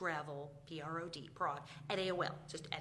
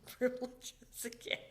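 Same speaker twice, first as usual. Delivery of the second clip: ordinary talk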